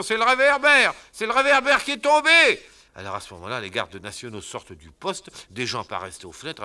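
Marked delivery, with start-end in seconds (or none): 0.0-2.6 high loud hasty reassurance; loud cover-up. Acted. Fadinard covering the crash, shouting. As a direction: shout it off as nothing.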